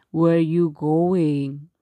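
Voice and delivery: slow, low, loud wolf; drawn-out sly wolf question. Acted. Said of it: "said the wolf".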